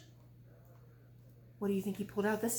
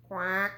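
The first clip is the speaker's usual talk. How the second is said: mock quack; 5 semitones lower than usual. Dog quacks in story.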